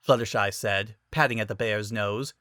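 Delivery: usual speaking voice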